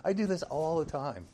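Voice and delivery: sheepish self-mocking laugh in the voice. Real, not acted. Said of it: "I'm sorry".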